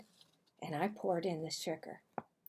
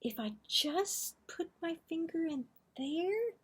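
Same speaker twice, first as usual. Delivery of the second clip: higher, whispered temptation; sneaky whisper; 9 semitones higher than usual. Acted.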